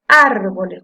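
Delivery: "Árboles" with "Ár" loud and leaned on; stressed first syllable. Shown as a demonstration. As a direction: hit the "Ár" of "Árboles". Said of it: "cuando yo la pronuncio".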